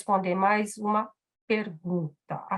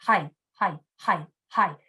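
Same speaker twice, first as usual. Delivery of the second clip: imitating foreigners' mechanical repeated acknowledgements; separate, evenly repeated listening noises, lightly mimicked; repetitive mock backchannel; 3 semitones lower than usual. Foreigner nodding along to a Japanese speaker, repetitive mock backchannel. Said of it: "que ficam falando toda hora".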